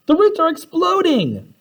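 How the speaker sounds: high mock scream of alarm. Wanda watching root hairs sprout, mock scream. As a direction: shriek in alarm. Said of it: "Wanda screamed".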